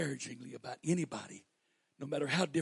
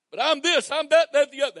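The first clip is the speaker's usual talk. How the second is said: high, louder imitation of a whiny excuse-maker; mock objector; 11 semitones higher, 14 dB louder than usual. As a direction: mimic the doubter brushing it off.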